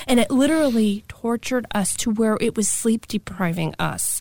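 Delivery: plain reading voice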